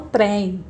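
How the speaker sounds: trem with a wide open é, like trém; wrong open vowel. Shown as a demonstration. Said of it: "Porque eu não falo trem".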